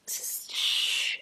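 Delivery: slow whispered shh; whispering that the divorce stays quiet. Retold or imitated. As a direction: hush it like a secret. Mimicking hushing a divorce.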